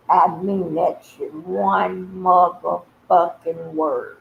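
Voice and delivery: slow, deliberate, every word weighted; slow emphatic rant. Real, not acted. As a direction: lay each word down slowly.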